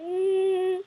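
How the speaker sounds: high, soft, drawn-out mock whimper; tearful whimper. Eyes watering at a sad scene, high soft whimper. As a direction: whimper through closed lips. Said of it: "my eyes started watering".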